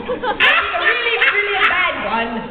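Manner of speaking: loud, hammed-up bad Cockney; Cockney accent. Shown as a demonstration. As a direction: put on a really bad Cockney, loud and high. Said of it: "I talked to him in Cockney accent".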